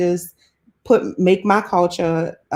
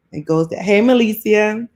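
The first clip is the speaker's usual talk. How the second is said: ordinary talk, nothing marked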